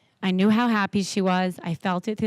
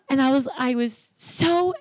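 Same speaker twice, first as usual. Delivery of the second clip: ordinary delivery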